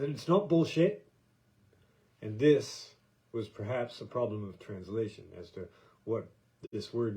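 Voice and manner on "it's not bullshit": mock Cockney soldier, higher and louder; imitating the Cockney guy. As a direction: quote the young Brit with punch. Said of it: "He said".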